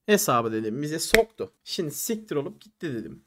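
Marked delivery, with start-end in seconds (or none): none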